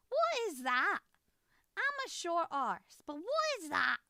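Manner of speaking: loud, high, incredulous outburst; shocked disbelief. Real, not acted. Streamer reacting to a roof in a video, loudly incredulous.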